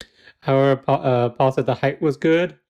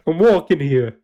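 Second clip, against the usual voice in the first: put-on New York accent; 3 semitones higher than usual. Joking host doing a mock New York accent for listeners. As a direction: bark it like a New York cabbie. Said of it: "we're judging your New York accent".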